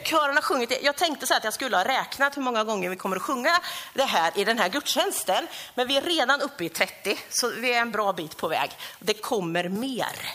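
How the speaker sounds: ordinary talk, nothing marked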